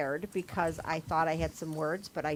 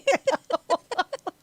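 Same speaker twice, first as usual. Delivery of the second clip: giggle; 13 semitones higher, 4 dB louder than usual. Real, not acted. Laughing at her Play-Doh cake story.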